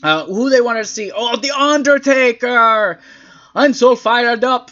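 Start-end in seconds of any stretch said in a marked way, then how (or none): 1.1-4.7 loud, drawn-out gushing fan impression; hammed-up thrill, high and slow; mock fan excitement. Host mimics fans interviewed before the show, mock-excited.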